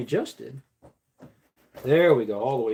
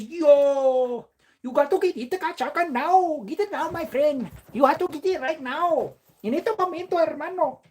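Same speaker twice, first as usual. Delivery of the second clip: hyped chat voice; 10 semitones higher than usual. Acted.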